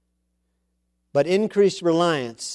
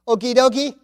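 a playful hint of an Italian accent, higher, with a laugh; laughing Italian-flavoured okie dokie; mock Italian reply; 10 semitones higher, 5 dB louder than usual. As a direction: toss off Tim's text in a jokey Italian lilt. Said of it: "This is a good Italian comment".

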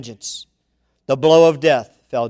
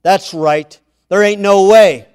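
higher, louder mocking taunt; jeering demons; 4 semitones higher, 9 dB louder than usual. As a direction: taunt from the crowd. Demons jeer.

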